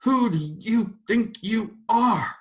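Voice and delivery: a final surge of fury; roared. A narrator's reading. Mrs. Turpin roaring at God, furious. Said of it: "she roared".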